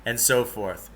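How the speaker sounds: ordinary delivery, nothing marked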